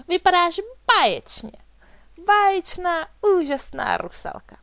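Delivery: high, loud gushing praise; enthusiastic, high-pitched exclaiming; delighted admiration. A narrator's reading. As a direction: proclaim it with thrilled admiration. Svatava admires the finished costume.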